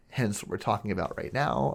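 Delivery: ordinary talk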